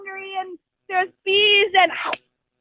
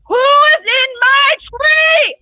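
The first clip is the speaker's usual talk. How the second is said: a slow, very loud, much higher roar; roaring shout; 8 semitones higher, 7 dB louder, much slower than usual. Acted. Angry lion Simba roars up into the tree.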